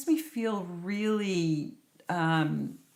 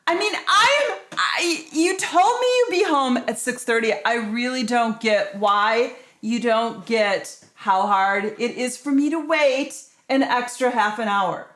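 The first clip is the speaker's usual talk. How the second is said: angry; 3 semitones higher, 7 dB louder than usual. Acted. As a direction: scold him for coming home late. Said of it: "You sound really angry".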